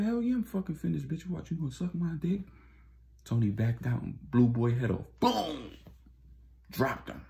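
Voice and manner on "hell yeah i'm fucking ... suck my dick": very high, sneering jeer; high mock jeer; mocking thug. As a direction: taunt her, cocky and crude. Dealer taunting Tony.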